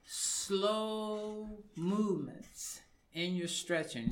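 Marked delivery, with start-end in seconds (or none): 0.0-2.8 SLOW stretched long and held; higher, lingering delivery of "slow movements"; slow, drawn out. Real, not acted. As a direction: draw out "slow movements" unhurriedly. Urging slow movements while stretching.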